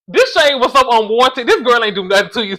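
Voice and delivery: high, loud, worked-up protest; indignant outburst. Real, not acted. Vlogger scolding Toya over needless shade, high and loud. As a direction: call out the shade like it hurt you. Defending a cast member from shade.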